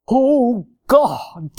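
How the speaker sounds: drawn-out pious "Oh, God"; mock prayer voice. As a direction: parody someone's affected praying. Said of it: "some people have prayer voices".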